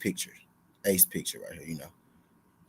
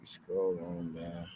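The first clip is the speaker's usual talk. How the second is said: mumbled to self; 4 semitones lower, 12 dB quieter, much slower than usual. Real, not acted. Muttered, slow.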